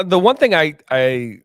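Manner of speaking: usual speaking voice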